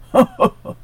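high, loud burst of laughter; ox guffaw. A narrator's reading. The ox bursts out laughing at the dog. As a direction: roar with laughter at the idea. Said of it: "s'exclaffa le bœuf".

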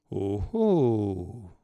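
a strange, knowing "oh, oh"; odd knowing tone. A narrator's reading. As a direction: sound sceptical and sly. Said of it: "d'un air singulier".

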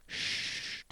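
soft, drawn shush; hushing. A narrator's reading. Mathilda silencing her family to listen, soft shush. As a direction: hush the room.

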